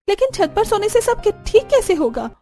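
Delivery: high-pitched, incredulous objection; protesting daughter-in-law. Acted. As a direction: question your mother-in-law, pitched well up. (विरोध करती बहू)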